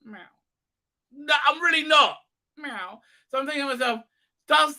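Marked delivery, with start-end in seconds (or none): 0.1-0.2 tiny clipped mew; cat meow. Retold or imitated. Cat begging its owner for milk, little meow. 1.1-2.2 loud, high-pitched mock scolding; firm refusal. Owner refusing the cat milk, loud and firm. 2.6-3.0 soft falling mew; cat meow. Retold or imitated. Cat still begging for milk, soft meow.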